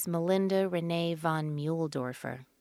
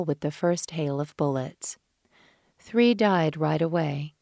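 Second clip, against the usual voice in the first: intense narration; 5 dB louder than usual. A narrator's reading. Tense action narration.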